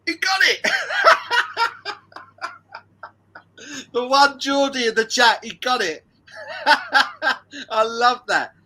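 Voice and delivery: real high-pitched, delighted cackling; creasing up. Real, not acted. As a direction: crack up laughing between loud, high exclamations. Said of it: "That's creased me up".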